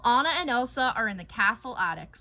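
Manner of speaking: ordinary delivery, nothing marked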